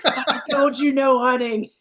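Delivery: high, drawn-out scolding; imitated angry boss. Timmons scolding handlers who went pig hunting, pissed. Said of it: "He'd be so pissed".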